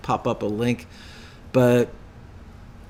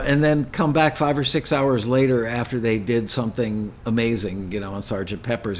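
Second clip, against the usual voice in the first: ordinary talk, nothing marked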